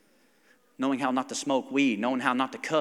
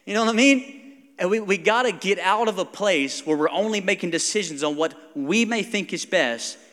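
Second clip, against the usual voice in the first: usual speaking voice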